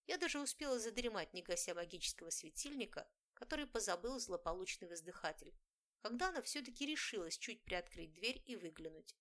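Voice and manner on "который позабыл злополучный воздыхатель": plain reading voice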